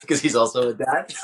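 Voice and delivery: laughing through the words; can't hold back the laugh while saying it. Real, not acted. Laughing while telling punchline.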